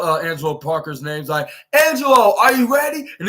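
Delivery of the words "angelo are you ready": mock call-out. Anna Jay calling out to Angelo Parker, mock yell. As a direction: call him like you're yelling backstage. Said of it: "Anna Jay screams out".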